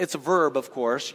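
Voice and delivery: ordinary delivery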